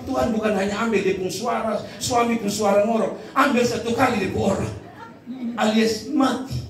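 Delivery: ordinary delivery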